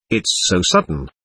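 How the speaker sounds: low, understated falter. A narrator's reading. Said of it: "faltered Katya".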